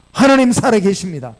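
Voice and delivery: loud, emphatic declaration; loud proclamation. Real, not acted. Preacher declaring faith to the congregation, loud and emphatic.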